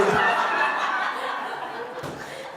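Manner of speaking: a quick breathy laugh at his own joke. Real, not acted. Preacher laughing at his own joke about being skinny, airy laugh. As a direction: let a soft airy laugh slip out.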